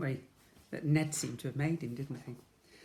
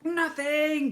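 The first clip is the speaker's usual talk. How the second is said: loud, high mocking bawl; 13 semitones higher, 7 dB louder than usual. A narrator's reading. Jemima bawling at Ned through the door, loud and high. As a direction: bawl it back at him. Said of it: "bawled Jemima".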